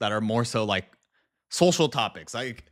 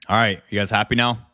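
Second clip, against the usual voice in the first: ordinary talk, nothing marked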